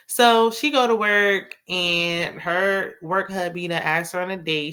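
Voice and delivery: normal talking voice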